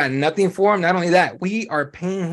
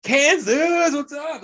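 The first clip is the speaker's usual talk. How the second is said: very high, teasing call-out; playful imitation of a provocative hello; mock crowd greeting; 11 semitones higher, much slower than usual. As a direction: hail the crowd in a pitched-up voice. Mimics fighter hailing a booing crowd.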